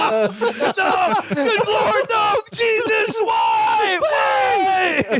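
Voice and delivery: laughing mock despair at the screens; mock anguished wail. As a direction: wail to the sky through laughter. Voicing the character's anguish.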